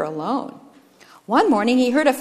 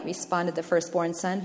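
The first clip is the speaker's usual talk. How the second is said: plain reading voice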